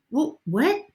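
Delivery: high, loud baffled what; incredulous. Retold or imitated. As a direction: act stunned and exasperated. Retold exasperation with mother.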